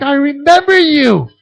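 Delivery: high, loud and drawn-out fake delight; mock delighted recognition. To the kid he once kicked out, hammed-up delight. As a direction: overplay glad surprise, slowly. Mock reply to former student.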